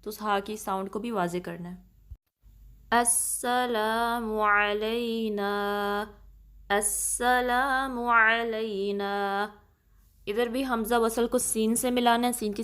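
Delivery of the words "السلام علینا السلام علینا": very slow, drawn-out recitation of the greeting. Shown as a demonstration. Teacher reciting the phrase for students to repeat. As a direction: stretch each vowel in a measured chant. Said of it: "اس طریقے سے ہم پڑھیں گے".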